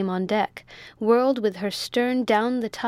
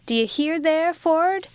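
high, raised hail; called out; 7 semitones higher, 4 dB louder than usual. A narrator's reading. Captain hails the men forward, calling out. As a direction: call forward to the crew in a higher pitch. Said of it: "called out Spike".

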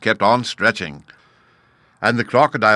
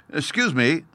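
polite; 5 semitones higher than usual. A narrator's reading. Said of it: "said the elephant's child most politely".

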